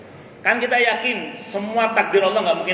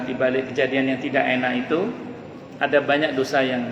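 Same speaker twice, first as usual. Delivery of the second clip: ordinary talk, nothing marked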